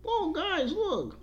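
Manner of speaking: very high-pitched mock excitement; mocking mimicry. Host imitates Christmas countdown people, mocking. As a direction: put on a silly high voice to mock them.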